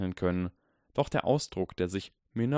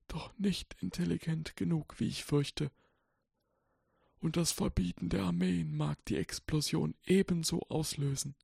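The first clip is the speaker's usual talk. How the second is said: put-on softly reciting old wizard; 9 semitones higher, 3 dB quieter than usual. A narrator's reading. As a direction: murmur it like ancient verse. Dumbledore voiced in story.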